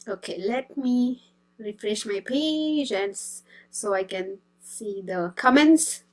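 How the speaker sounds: normal talking voice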